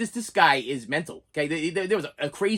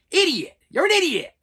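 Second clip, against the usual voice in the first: angry; 10 semitones higher, 4 dB louder than usual. Real, not acted. Ranting at the video maker.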